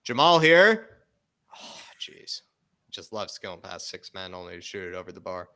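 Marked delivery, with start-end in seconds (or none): 0.0-1.1 loud, high, slow excited call. Real, not acted. Chance building on TV. 1.6-2.4 breathy disappointed whisper; whispered groan. Real, not acted. Shot goes over the bar.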